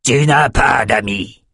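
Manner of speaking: slow, menacing king's growl. A narrator's reading. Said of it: "grogna Calvert".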